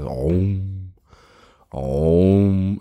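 long, droning "om" held on one note; chanted oracle "om"; oracle's trance hum. Acted. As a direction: intone a drawn-out meditative hum.

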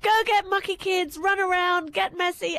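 put-on high, breezy call to the kids; breezy retold parent calling out; carefree urging. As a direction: send the kids off to play, lightly acted.

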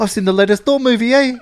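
put-on high Kiwi accent with eh. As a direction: tack on a Kiwi eh. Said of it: "That is a Kiwi accent".

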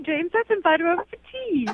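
very high-pitched put-on British accent; mock British parent. Woman mocks her date's London parents on a call, exaggerated British accent. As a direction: play his parents as hammed-up chirping Brits. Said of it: "call my parents in London".

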